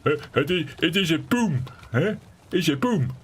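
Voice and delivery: high, hammed-up Dutch English; Dutch accent. Mimicking Dutch guys, high mock Dutch accent. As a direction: camp it up like a Dutch guy. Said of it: "that's what some Dutch guys will do".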